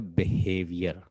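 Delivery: ordinary talk, nothing marked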